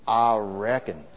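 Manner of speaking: slow, low Southern "I reckon"; southern accent. Shown as a demonstration. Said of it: "you gotta get the southern accent".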